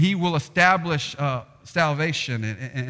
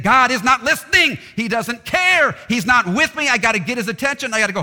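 high, loud frantic pleading; hammed-up desperate worshipper; anxious pagan; 10 semitones higher, 7 dB louder than usual. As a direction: panicky pagan begging to be noticed. Preacher voicing anxious pagans.

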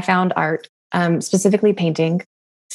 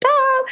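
sudden high startled cry; a mock panic shriek, like an alarm going off; alarmed yelp. Retelling her panic at the canvas, a high startled yelp.

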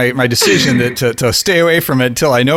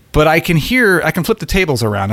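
ordinary talk

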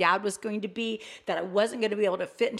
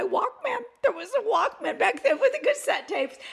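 laughing through the words, voice jumping much higher; 12 semitones higher than usual. Real, not acted. Wife recalling her Walkman to the host, laughing. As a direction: giggle at the memory of cassette tapes.

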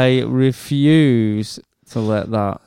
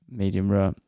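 ordinary talk

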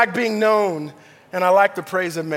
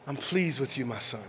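even softer, lower, fading repeat; 8 semitones lower, 13 dB quieter than usual. Retold or imitated. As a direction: let it fade almost to nothing. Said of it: "God telling me".

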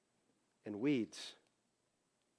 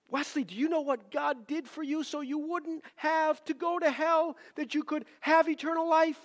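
much higher and louder, pressing the caller hard; retold earnest plea to a phone scammer; urgent pleading; 10 semitones higher, 3 dB louder than usual. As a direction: plead with him like his soul depends on it.

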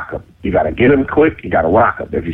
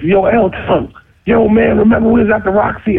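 raised, high retold call; 11 semitones higher than usual. Visitors at the dorm door reminding him, calling out. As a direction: plead to be remembered through a door.

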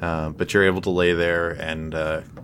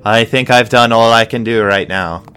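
the healer curtly takes leave of the priest, raised voice; 3 semitones higher, 7 dB louder than usual. Acted. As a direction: wrap it up curtly. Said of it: "he nods, uh, curtly and says".

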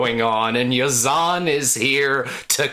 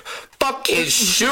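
high, drawn-out indignant rant; hammed-up indignation; mock outrage; 10 semitones higher, slower than usual. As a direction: rant it high and stretch the words. Ranting, acted abuse of someone.

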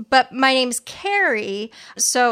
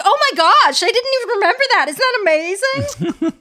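high, loud mock delight with a laugh; acting thrilled; 9 semitones higher, 5 dB louder than usual. Psychic Cindy faking delight at the hit, high gushing laugh. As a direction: oversell it like a huge hit. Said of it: "Cindy acts like this is, like, a real big hit".

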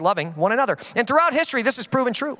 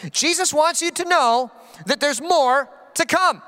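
normal talking voice

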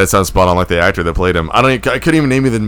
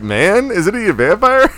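incredulous; 8 semitones higher, slower than usual. Retold or imitated. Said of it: "Pepito was like".

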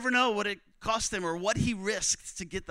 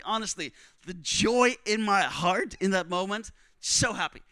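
normal talking voice

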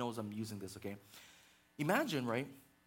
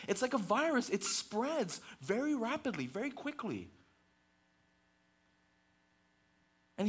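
higher and louder, driving the point home; preaching at full force; 9 semitones higher, 4 dB louder than usual. Acted. Pastor warning the congregation about gossip, preaching hard. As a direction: build the sermon to a pulpit climax.

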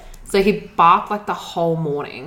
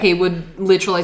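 ordinary delivery

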